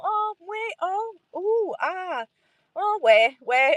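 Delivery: high, cooing listener reactions; feigned French understanding. Retold or imitated. Faking an hour's conversation with a French guy, high feigned interest. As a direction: nod along vocally with airy ohs and ahs.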